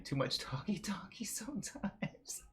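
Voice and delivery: breathy whispered repeat of the phrase; teasing stage whisper. Shown as a demonstration. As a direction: drop to a secret whisper.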